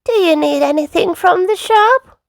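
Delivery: high granny character voice; Granny Pig. A narrator's reading. Granny offering shopping, high questioning voice. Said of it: "Granny Pig asked".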